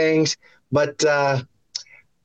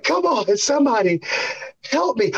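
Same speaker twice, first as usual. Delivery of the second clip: high, whining plea for help; mock despair; 7 semitones higher than usual. Watching his player struggle at practice, high whining plea. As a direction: cry out helplessly, half joking. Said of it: "I was just like".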